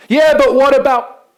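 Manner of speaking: high, loud pleading objection; anxious objection cut off mid-sentence; protesting. Pretend. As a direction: whine out the excuse. Imagined objections, brushed off.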